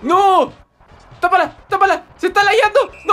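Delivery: high, frantic match shouts; high-pitched panicky cheering; urgent calling out. Real, not acted. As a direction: urge a teammate to block. Urging a block in game.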